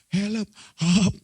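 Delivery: high, gulping mock plea; drowning cry. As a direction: act out going under while calling. Yunus tenggelam minta tolong. (pura-pura tenggelam sambil minta tolong)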